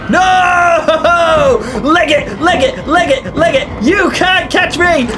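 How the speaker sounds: high, loud yelling as she flees; panicked shout. Acted. Streamer fleeing the game enemy, screaming in panic. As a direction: scream "leg it" while running for your life.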